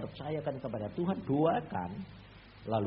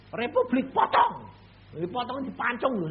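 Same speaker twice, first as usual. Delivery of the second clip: dramatic; 9 semitones higher, 8 dB louder than usual. Acted. Dramatic warning.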